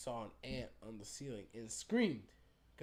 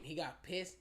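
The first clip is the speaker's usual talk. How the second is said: usual speaking voice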